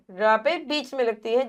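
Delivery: normal talking voice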